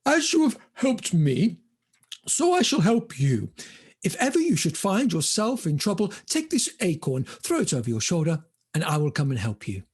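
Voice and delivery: posh squirrel, starting high and settling down; posh, high start easing to normal pitch; rather posh voice. Acted. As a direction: speak grandly as a well-bred squirrel. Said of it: "in a rather posh voice".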